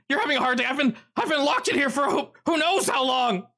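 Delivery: high, startled man's voice; a jittery prisoner caught off guard; nervous trapped man. Acted. GM voices Rick.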